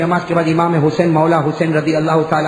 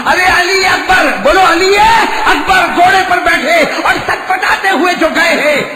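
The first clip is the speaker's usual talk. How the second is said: high, loud anguished call; preaching cry; 9 semitones higher, 5 dB louder than usual. Acted. Preacher to congregation, high loud lament. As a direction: cry out the son's name to the crowd.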